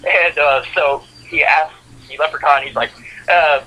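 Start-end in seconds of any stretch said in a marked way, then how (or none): none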